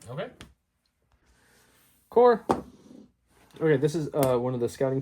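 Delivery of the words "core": sudden loud call-out. Real, not acted. Player calling out the next phase, loud. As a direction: bark the word out high.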